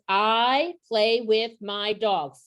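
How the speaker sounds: each word spaced out slowly for counting. Retold or imitated. Modeling a sentence for kindergartners, slow and word by word. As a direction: lay the sentence out one slow word at a time. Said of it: "five words".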